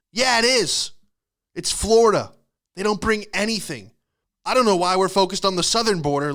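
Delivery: high, loud comic indignation; exasperated mock rant. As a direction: snap back in mock outrage. Mock rant back at Canadian neighbour.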